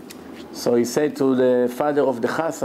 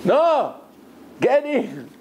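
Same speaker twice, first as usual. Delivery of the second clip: much higher, pushy "no, get in"; mock order; 8 semitones higher, much slower than usual. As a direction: bark it at the old man, teasing. Driver mocking, ordering him back.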